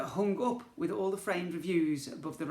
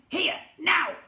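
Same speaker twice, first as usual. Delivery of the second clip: high, loud goblin shout; 10 dB louder than usual. A narrator's reading. Goblin prince shouting at the family, high and loud. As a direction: shout the order. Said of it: "he shouted".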